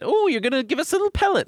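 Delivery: teasing squeaky high voice; high mock voice. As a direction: pitch way up, mock whine.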